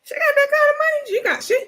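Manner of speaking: high, fast shocked squeal; real shock at the money; shocked disbelief. Real, not acted. Hearing she bought a condo, shocked.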